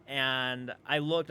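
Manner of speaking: usual speaking voice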